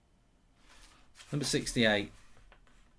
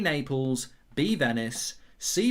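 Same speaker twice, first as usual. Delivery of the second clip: ordinary talk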